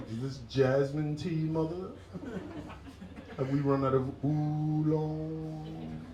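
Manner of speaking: high, drawn-out emperor's question; young Chinese emperor. Acted. Luxuriating emperor asks mother for tea, high and leisurely. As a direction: play a pampered young emperor, stretching the last word. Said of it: "live the life of a young Chinese emperor".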